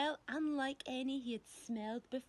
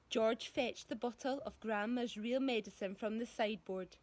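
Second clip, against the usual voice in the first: plain reading voice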